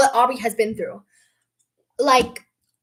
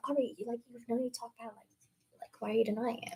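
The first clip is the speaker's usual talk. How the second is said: softer, whispered accusation; mocking hushed accusation; 4 semitones lower, 16 dB quieter, faster than usual. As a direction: hiss it like a sneaky accuser. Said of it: "it's like".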